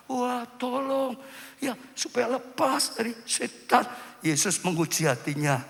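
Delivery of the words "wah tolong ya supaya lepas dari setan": high, drawn-out mock plea; lightly acted cry for help; pleading mother. Acting mother's plea to Jesus. (ratapan minta tolong yang dibuat-buat)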